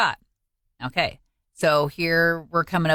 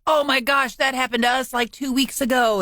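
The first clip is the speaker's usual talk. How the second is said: higher-pitched gushing mock quote; excited retelling; 8 semitones higher than usual. Husband reacting to a shared mishap, excited. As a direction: relay his surprise in a raised voice. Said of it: "and he's like".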